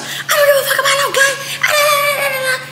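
very high-pitched squawking imitation; squeaky, hammed-up arguing back; mock ranting. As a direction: mimic her shrill tirade dissolving into babble. Mimics girl arguing back, not listening.

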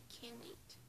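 ordinary talk, nothing marked

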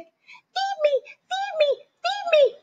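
a hungry duckling begging Jack; hungry baby duck. Acted. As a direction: beg like a starving duckling. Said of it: "cried the hungry baby duck".

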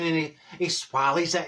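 ordinary talk

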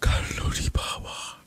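hushed, slow whisper so the enemy won't hear. Acted. Whispering to teammate while hiding from the enemy. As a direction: breathe it out without voice. Sneaking past enemy in game.

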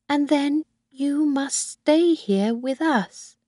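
put-on a high old woman pleading with her son; old Mrs Harvey. Old woman voiced by reader.

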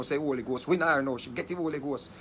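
ordinary talk, nothing marked

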